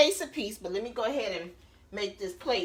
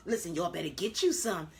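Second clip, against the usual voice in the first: normal talking voice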